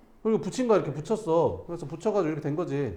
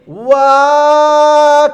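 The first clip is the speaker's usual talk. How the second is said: high, loud, long drawn-out wow; amazed wow; 13 semitones higher, 20 dB louder, much slower than usual. Pretend. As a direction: cheer like the rolling ball is a show. Teacher acting ball rolling.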